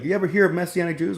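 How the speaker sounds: high, fast, eager uncle voice; imitating the uncle. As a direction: pitch it up like a keen recruiter. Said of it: "And he's like".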